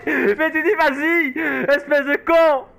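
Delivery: laughing. Real, not acted. Laughing insult after big laugh.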